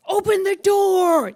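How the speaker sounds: high, loud drawn-out yell; retold yell. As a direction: yell it at the driver, stretching the words. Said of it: "they yell at the driver".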